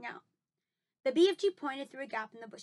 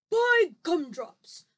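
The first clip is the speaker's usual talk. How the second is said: BFG voice; 6 semitones higher, 10 dB louder, much slower than usual. A narrator's reading. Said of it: "whispered the big friendly giant".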